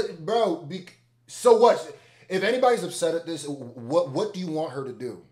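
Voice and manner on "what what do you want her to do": ordinary delivery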